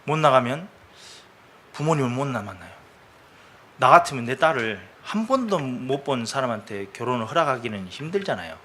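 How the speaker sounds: usual speaking voice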